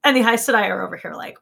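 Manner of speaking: ordinary talk, nothing marked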